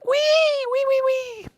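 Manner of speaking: very high, loud squealing wee; piglet squeal. Acted. Storyteller squeals as the little pink pig. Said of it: "learning to squeal".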